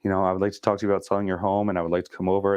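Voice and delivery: rushed, breathless first cold call; nervous rushed pitch. Retold or imitated. Rookie agent rushing through first cold call, fast. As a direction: blurt it all out fast. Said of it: "I was like".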